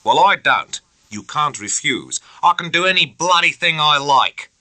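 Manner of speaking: hot-tempered officer snapping back; flaring up. A narrator's reading. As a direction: flare up at him. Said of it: "Bennett flared up".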